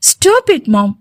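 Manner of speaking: sharp, high shout; angry outburst. Acted. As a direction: cut her off hard. Son snaps at his mother.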